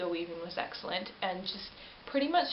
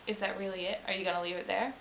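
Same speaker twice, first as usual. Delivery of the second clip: ordinary delivery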